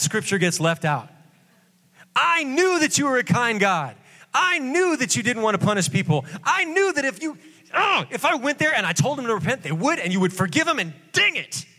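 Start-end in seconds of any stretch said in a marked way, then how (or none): 2.1-11.6 high, petulant complaint; whiny Jonah. Acted. Jonah complaining to God about his mercy, high and whiny. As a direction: sulk at God for being kind. Preacher rereads Jonah's rant.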